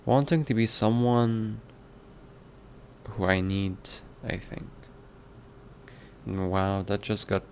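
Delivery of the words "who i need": normal talking voice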